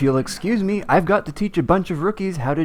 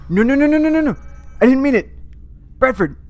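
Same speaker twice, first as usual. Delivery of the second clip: mock panic; 10 semitones higher than usual. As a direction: beg frantically, much higher. Panic after offending Australia.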